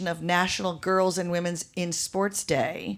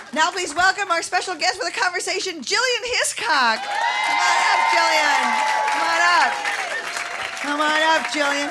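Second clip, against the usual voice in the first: raised, high announcer voice calling the guest up; announcer welcome; 8 semitones higher than usual. Real, not acted. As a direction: call her up to the stage. Host introducing guest on stage.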